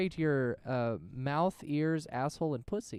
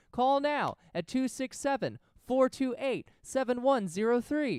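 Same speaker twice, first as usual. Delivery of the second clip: excited announcer; 7 semitones higher, 6 dB louder than usual. Acted. Hyped phone number plug.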